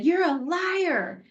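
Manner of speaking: put-on high, slow, taunting kid voice; imitating a kid sneering back; mock childish accusation; kid's retort. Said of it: "And the other kid said".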